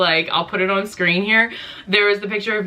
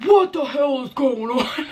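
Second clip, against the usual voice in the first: higher, slow, laughing mock dad; startled dad; 8 semitones higher than usual. Dad walking into the ER, mock alarmed and laughing. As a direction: play the dad barging in, baffled and amused. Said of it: "He's like".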